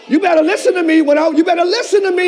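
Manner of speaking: loud, high preaching shout, climbing to the last word; full-force preaching. Real, not acted. Preacher urging congregation to listen to him, fervent shouted preaching.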